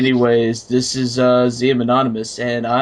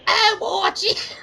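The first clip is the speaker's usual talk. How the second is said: mock high voice; slower than usual. Joking mock voice.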